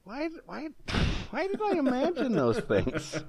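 high, giggling disbelief; laughing disbelief. Real, not acted.

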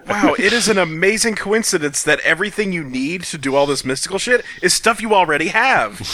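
ordinary talk, nothing marked

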